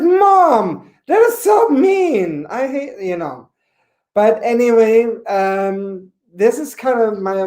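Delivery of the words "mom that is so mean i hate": hurt whine. Retold or imitated. Hurt retort to mom.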